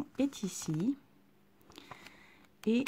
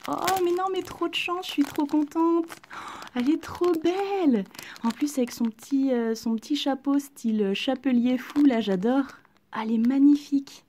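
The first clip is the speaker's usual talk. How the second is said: high, loud burst of delight; thrilled; 5 semitones higher, 5 dB louder than usual. Real, not acted. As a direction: gush over the lucky pull. Pulls the doll she wanted. (ravie, aiguë et forte)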